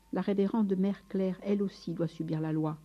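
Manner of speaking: usual speaking voice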